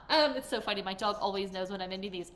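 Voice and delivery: ordinary talk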